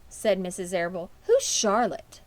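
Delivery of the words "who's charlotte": a hint of a worried mother asking; lightly voiced concerned question; puzzled mother. A narrator's reading. Mother asking Fern who Charlotte is, puzzled.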